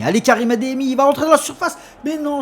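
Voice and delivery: rising, loud build-up; excited sports commentary. Acted. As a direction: call the attack as it builds.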